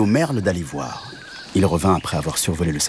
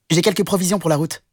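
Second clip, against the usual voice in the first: higher, fast rabbit chatter; little rabbit voice; 9 semitones higher, faster than usual. Acted. Rabbit shows the cow his carrots, quick high rabbit voice. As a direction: rattle it off like a busy rabbit. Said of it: "dit le lapin".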